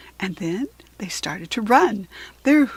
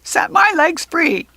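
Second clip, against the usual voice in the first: high, slow whining goblin; stuck goblin whining to be let out; muffled goblin wail; 8 semitones higher, 8 dB louder, slower than usual. A narrator's reading. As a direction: plead from inside the costume. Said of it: "muffled wail".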